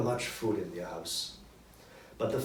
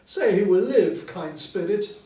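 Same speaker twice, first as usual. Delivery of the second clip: higher, much louder pleading; desperate plea; 8 semitones higher, 8 dB louder than usual. A narrator's reading. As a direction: beg the spirit to spare the child. Scrooge begging the ghost.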